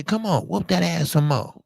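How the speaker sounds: daring him to hit harder; mock taunt. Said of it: "I'm gonna say".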